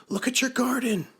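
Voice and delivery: very high, eager character voice; excited calling. A narrator's reading. Frog shows Toad his garden, high excited call. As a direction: announce good news in a high voice.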